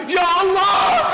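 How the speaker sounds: loud, slow, high cry to God; overwhelmed cry. Acted. Bilal overwhelmed seeing the grandsons. (seruan keras dan panjang penuh haru)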